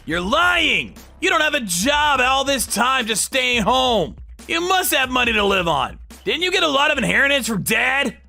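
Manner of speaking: high, acted indignation; accusing son. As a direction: press Mom with accusations. Brother accuses mother.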